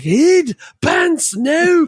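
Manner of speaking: hammed-up Scottish shout; Scottish dad quote. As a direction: bellow it like the movie father. Quoting movie line at family.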